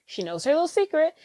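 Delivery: ordinary talk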